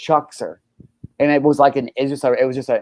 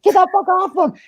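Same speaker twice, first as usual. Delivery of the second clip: high, louder mock yell of a girl; yelling girlfriend; 3 dB louder than usual. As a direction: shriek it like she's pulling him off. Girlfriend choking attacker, retold.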